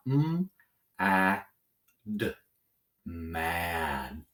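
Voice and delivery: M-A-D as separate drawn-out sounds, then MAD blended slowly; slow sounding out. Shown as a demonstration. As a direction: stretch each sound of M-A-D, then join them into MAD. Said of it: "Let's say it slowly. Sound it out".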